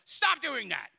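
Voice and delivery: a preacher's example of yelling at them; mock yelling. Shown as a demonstration. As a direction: demonstrate a telling-off, loud and high. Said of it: "I'm not yelling at you like".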